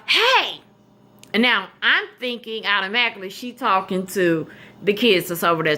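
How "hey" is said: retold yell. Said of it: "she yells out".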